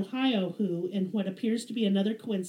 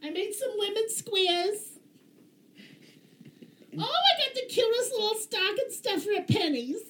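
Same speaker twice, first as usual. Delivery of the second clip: much higher, put-on chirpy mom voice; mocking lame mom voice; 11 semitones higher than usual. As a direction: parody two dull ladies swapping treats, pitched way up. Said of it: "They get together like".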